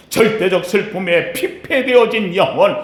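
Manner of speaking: ordinary delivery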